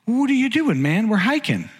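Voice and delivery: high-pitched, disbelieving retold question; mock incredulity. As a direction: pitch it way up, baffled at your friend. Acting out incredulity at hiding friend.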